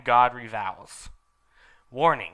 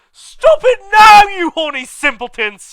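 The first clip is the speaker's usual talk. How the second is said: McGoggle shouting; 18 dB louder than usual. Acted. Professor McGoggle shouting at the couple caught in class. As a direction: scold them at full volume. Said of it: "shouted Professor McGoggle".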